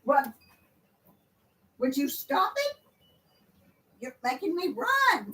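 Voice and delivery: high, soft fond scolding; soft pet scolding. Real, not acted. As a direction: tell the cat off gently up high.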